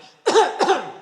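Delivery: one loud, sharp, echoing cough; big loud cough. Retold or imitated. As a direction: cough hard, once. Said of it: "I stepped over and I went".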